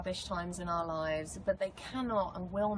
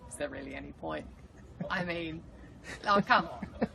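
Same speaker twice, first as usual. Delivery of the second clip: mock despair. Joking with casting at her audition, in mock despair. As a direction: pretend to give up, then complain.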